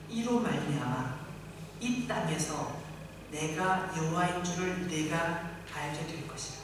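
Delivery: solemn reading of the verse; scripture reading. Real, not acted. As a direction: read the verse slowly and gravely. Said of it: "이십이 절에 그 답이".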